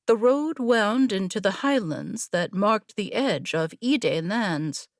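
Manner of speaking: usual speaking voice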